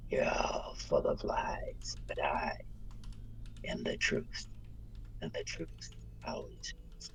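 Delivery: slow soft whisper, cold; villain whispering her farewell to the burning cathedral; whispered menace. Acted. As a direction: breathe it as a final threat. Quiet parting line amid inferno.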